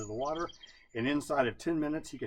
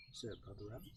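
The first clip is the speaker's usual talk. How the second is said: ordinary delivery, nothing marked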